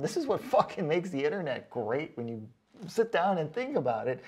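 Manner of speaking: ordinary talk, nothing marked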